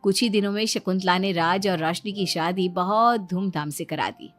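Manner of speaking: usual speaking voice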